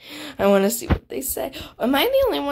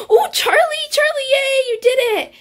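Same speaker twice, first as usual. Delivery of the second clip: high squeal of congratulations; thrilled cheering; 4 dB louder than usual. Real, not acted. Player cheering Charlie on, high excited squeal. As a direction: cheer high for a friend's win. Cheering friend's success in game.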